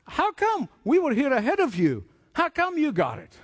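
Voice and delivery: mock furious applicants; indignant protest. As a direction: demand an answer, offended. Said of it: "they were furious".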